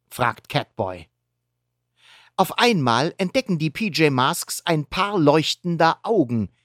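ordinary delivery

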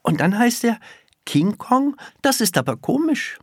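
put-on high child's voice, disbelieving; small girl wondering aloud, pitched right up; puzzled little girl. A narrator's reading. Girl puzzles over the name.

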